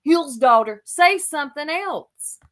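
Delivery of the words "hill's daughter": louder, mocking repeat of a stock phrase; sneering echo, a bit louder each time; mocking parrot. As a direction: parrot it back with scorn. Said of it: "I don't wanna hear".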